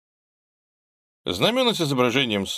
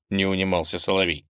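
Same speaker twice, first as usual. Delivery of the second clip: ordinary delivery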